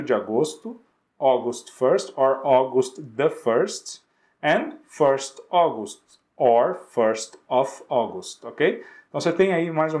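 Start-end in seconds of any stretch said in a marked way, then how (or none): 1.2-8.4 slow, careful list of date forms; slow model dates. Shown as a demonstration. Teacher listing date options for students, slowly. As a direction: read each option slowly. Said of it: "another example".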